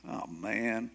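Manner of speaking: low, soft mock groan of dismay; acted muted disappointment; deflated. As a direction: let it sag, quietly crestfallen. Elijah reacts to being replaced.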